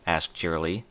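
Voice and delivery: plain reading voice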